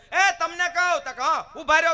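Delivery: wife calling out. Retold or imitated. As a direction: yell after him again.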